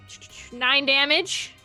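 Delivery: ordinary talk, nothing marked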